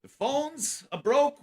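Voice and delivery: slow, high mock impression of a sulky coworker; imitating Mars. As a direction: drag it out, rising at the end. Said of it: "when he used to come in".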